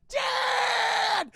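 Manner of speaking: long, high-pitched yell; hammed-up boy's cry; retold shout. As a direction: scream out for your father, drawn out. Son sees his father attacked.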